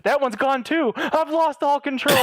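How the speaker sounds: mock panic. Caller pranking tech scammer about dead keys, mock panic. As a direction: wail it in hammed-up panic.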